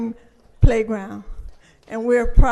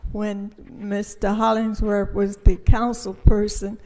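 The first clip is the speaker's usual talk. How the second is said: ordinary talk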